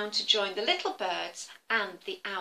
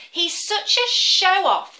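high, loud, slow complaint; fed-up birds moaning; irritated birds, high and loud; grumbling birds; 9 semitones higher, 9 dB louder, slower than usual. Acted. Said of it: "they said".

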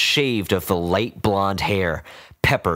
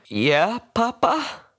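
lightly voiced young man; slower than usual. A narrator's reading.

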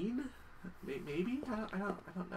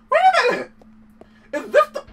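much higher excited yell; excited realization; 7 dB louder than usual. Real, not acted. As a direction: blurt it out in sudden recognition. Sudden recognition of cutscene.

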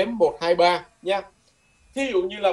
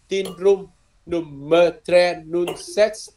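slow model repeat; much slower than usual. Shown as a demonstration. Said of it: "Đây là cái chìa khóa".

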